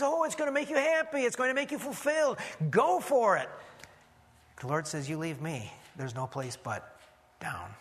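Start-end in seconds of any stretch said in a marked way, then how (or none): 0.0-3.5 high, quick, eager sales pitch; gushing temptation. Acted. Preacher voices the world promising happiness, gushing. 5.1-7.7 low, soft pronouncement sinking to the last word; the Lord's warning. Acted. Preacher voices the Lord warning the wayward, grave and low.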